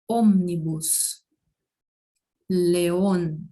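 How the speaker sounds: "ônibus", "leão" as slow Spanish ómnibus, león; accented O unchanged. Shown as a demonstration. Teacher showing accent leaves vowel alone, careful Spanish O. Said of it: "continua sendo O".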